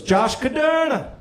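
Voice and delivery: slow, high Jamaican lilt on "Josh Caderna"; upbeat Jamaican voice calling the name; Jamaican accent. Retold or imitated. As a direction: sing the name like the Jamaican receptionist. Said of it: "this positive Jamaican woman".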